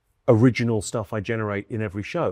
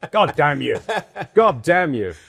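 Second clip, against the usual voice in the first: laughing curse; 4 semitones higher, 5 dB louder, slower than usual. Real, not acted. Laughing at the heckle story.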